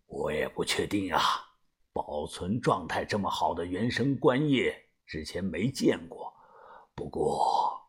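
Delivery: a frowning veteran weighing his words; deep, quiet and troubled leader; worried old boss. A narrator's reading. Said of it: "把头眉头紧锁地说道".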